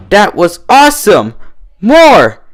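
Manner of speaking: high, loud rowdy cheer; tipsy shout. A narrator's reading. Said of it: "Rainbow Dash shouted".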